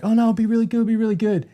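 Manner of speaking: high-pitched imitation of hopeful optimism; high, sing-it-up quoted voice; hopeful mock reassurance. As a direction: pitch it up high, acting out naive hope. Retelling hopeful talk before film.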